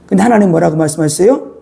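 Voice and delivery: usual speaking voice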